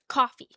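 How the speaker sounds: "Coffee" with emphasis on the aw and the e; New York coffee. Shown as a demonstration. Streamer demonstrates New York emphasis, coffee with stressed vowels. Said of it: "on the aw and the e".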